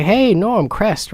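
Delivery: high, drawn-out fan's hello; acted friendly greeting. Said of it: "so that way I can be like".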